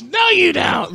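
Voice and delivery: mocking shouted imitation. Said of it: "do that voice again".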